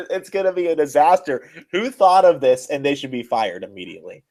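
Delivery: normal talking voice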